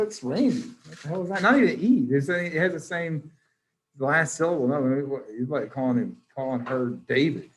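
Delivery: usual speaking voice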